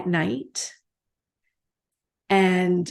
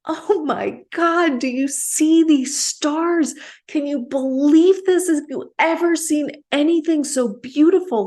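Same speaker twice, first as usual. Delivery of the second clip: much higher-pitched, louder cry of amazement; excited mock shout, pitched way up; retold awestruck shout; 8 semitones higher, 5 dB louder than usual. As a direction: re-enact calling out in ecstatic wonder. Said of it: "and I'm shouting".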